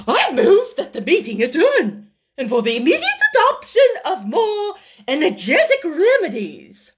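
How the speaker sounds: loud, high, grandiloquent motion; pompous Dodo. A narrator's reading. The Dodo addresses the meeting, loud and grand. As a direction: proclaim like a chairman. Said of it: "said the Dodo solemnly".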